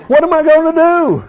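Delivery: high, loud mock panic; playing the frantic shopper at the checkout. Preacher mimics a panicked shopper at checkout, high mock panic.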